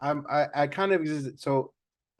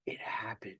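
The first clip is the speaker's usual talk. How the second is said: breathy whisper for effect; 3 semitones lower, 13 dB quieter than usual. Retold or imitated. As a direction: drop to a whisper. Whispered punchline in quote.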